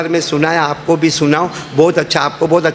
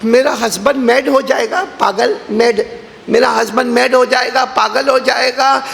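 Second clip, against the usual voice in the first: higher-pitched, hammed-up wailing wife; mock crying wife; 6 semitones higher than usual. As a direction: ham up the wife's tearful wail. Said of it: "تو بیوی رونے لگی". (مذاقیہ انداز میں روتی ہوئی بیوی)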